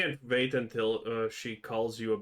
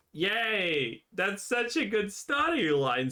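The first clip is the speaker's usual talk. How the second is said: mock-enthusiastic, pitched up at the start and end; sarcastic praise; 4 semitones higher than usual. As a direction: gush insincerely over a story you despise. Mocking praise, then said no one ever.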